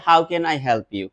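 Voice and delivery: normal talking voice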